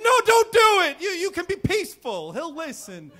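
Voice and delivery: mother begging her son not to fight, high-pitched; pleading mom. Retold or imitated. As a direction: beg him in a high, frantic voice. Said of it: "my mom's like".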